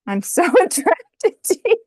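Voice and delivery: cracking up while reading the card; high, loud giggle through the words; trying to hold in laughter and failing; laughing. Real, not acted. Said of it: "I shouldn't laugh".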